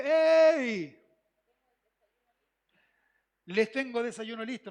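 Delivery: a loud shout across the water; calling out from shore. Acted. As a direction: call them loudly from the beach. Jesus calls from the shore. (llamando fuerte desde la orilla)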